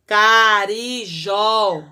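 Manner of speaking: "Carijó" very slow and loud, ca-ri-jó; syllable by syllable. Shown as a demonstration. Teacher sounds out the word to show the accent. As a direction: spell out "Carijó" slowly, stressing "jó". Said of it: "acento agudo no O do jó".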